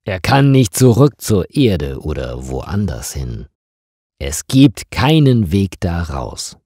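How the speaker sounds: ordinary delivery, nothing marked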